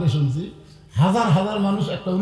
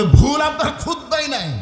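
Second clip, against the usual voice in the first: high, raised-voice objection; challenging retort; 10 semitones higher than usual. Acted. Congregant objecting to Omar's sermon, high challenging retort. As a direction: throw it back, pitched well up.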